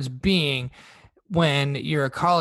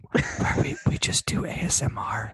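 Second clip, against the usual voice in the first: ASMR whisper; 3 semitones lower than usual. Pretend. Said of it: "the A S M R Minute".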